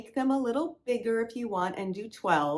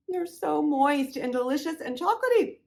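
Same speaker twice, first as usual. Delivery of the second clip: excited, louder, mouth still full; 8 semitones higher, 6 dB louder than usual. Real, not acted. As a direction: talk through a mouthful of brownie. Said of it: "sorry, I'm talking with my mouth full".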